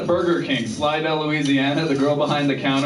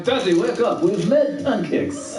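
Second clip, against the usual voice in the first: high mock Russian accent, stretched ending; kids' wake-up call in thick Russian; 6 semitones higher, slower than usual. Two kids waking their dad, in a Russian accent. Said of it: "my kids to get that accent".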